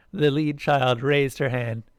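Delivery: plain reading voice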